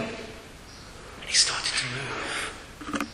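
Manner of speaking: very slow, near-whispered wonder; hushed suspense. Real, not acted. Preacher telling of the dying bird's revival, hushed suspense. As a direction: drop to a slow, breath-held hush.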